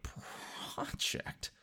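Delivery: drawn-out, hushed disbelief; breathy stretched-out echo; mock awed echo. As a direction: whisper it slowly in mock wonder. Retold awe at mechanic's word.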